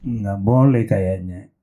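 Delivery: coy reluctance, hoping to be persuaded; playing hard to get. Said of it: "[name] kan biasa jual mahal". (jual mahal, pelan dan malu-malu)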